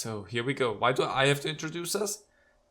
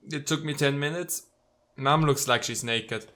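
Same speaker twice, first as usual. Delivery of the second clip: ordinary talk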